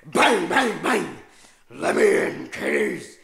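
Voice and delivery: loud menacing stranger voice at the window; scary mock yell. Babysitter yelling at kids through the window to scare them. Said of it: "I'd pound on the window and I'd go".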